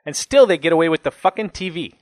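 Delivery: exasperated, aggrieved outburst; angry. A narrator's reading. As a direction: fume at the injustice. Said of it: "He snorted an angry breath".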